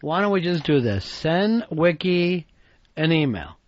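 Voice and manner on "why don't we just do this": ordinary talk, nothing marked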